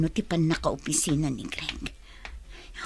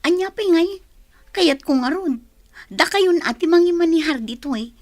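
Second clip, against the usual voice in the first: higher, louder heated protest; arguing back; 8 semitones higher, 4 dB louder than usual. Acted. Daughter insisting to her father, heated. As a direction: argue your case, heated.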